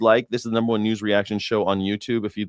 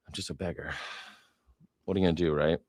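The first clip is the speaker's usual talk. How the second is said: ordinary delivery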